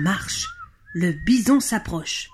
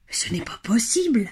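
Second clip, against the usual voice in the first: whispered disbelief; stunned whisper; 3 semitones higher than usual. Acted. Billy's father sees the bison, whispering in disbelief. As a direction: let it out in an astonished whisper.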